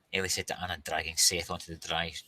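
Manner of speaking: ordinary delivery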